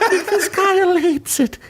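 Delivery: high, eerie voice through a laugh; creepy voice. Pretend. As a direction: tease in a creepy villain voice. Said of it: "to do my creepy voice".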